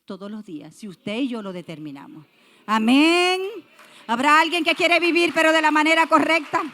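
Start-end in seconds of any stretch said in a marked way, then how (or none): 2.7-3.4 a big, much louder amen, pitched up; booming pulpit amen; shouted amen. Real, not acted. 4.1-6.6 loud and higher, speeding up at the end; rally the room with a challenge; fiery pulpit question; preaching call. Real, not acted. Preacher calls to congregation.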